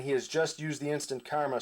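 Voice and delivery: ordinary talk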